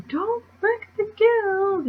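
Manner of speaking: very high, drawn-out mock wail; hammed-up high-pitched protest; mock outcry. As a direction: stretch it out in a squeaky falsetto cry. Voicing a character's famous cry.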